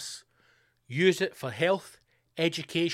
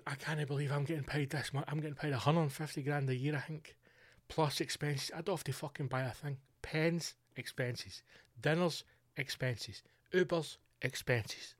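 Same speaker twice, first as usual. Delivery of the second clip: softer, secretive delight at the perks; gleeful hushed aside; 8 dB quieter than usual. Pretend. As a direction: murmur it as if nobody should hear. Voicing a new MP's private glee.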